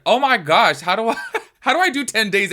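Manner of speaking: loud, high-pitched, laughing exclamation; overwhelmed disbelief. Acted. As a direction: exclaim in mock despair, breaking into a laugh. Exasperated reaction to own workload.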